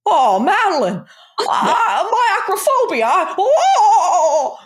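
high, hammed-up panicked wail; mock leading man overcome by heights; Jimmy Stewart. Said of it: "picture Jimmy Stewart".

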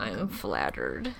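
low, slow dry sarcasm; deadpan sarcasm. Real, not acted. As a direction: say it bone-dry. Sarcastic reply to penis joke.

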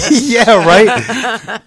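cracking up at a friend's joke; laughing hard. Real, not acted. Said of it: "I'll take the two nickels you got left".

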